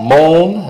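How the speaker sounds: "moan" said long with a nasal vowel, like moaning in pain; nasal moan sound. Shown as a demonstration. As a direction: stretch "moan" out, nasal, like a groan. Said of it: "like you're moaning in pain".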